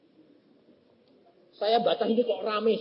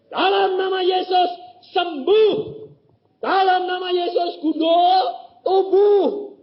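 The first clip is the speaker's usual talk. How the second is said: much higher, louder faith command; commanding declaration; 13 semitones higher, 8 dB louder, slower than usual. Shown as a demonstration. Preacher modelling a bold healing declaration, loud and high. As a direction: proclaim the healing with force.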